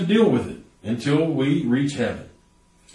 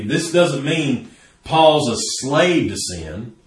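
ordinary talk

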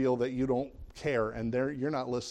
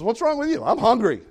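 wife snapping higher and louder; mock angry, higher and louder; the wife's hangry outburst at her husband; 9 semitones higher, 6 dB louder than usual. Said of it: "And she says".

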